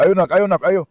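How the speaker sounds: calling out. Real, not acted. Pigeon keeper calls up to his birds, loud and eager. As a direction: call the pigeons home from below.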